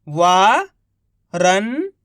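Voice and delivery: each syllable drawn out very slowly; slow syllable-by-syllable reading. Shown as a demonstration. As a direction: sound out सुरंग slowly as सु-रं-ग, one sound at a time. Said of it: "एक अक्षर का उच्चारण करके".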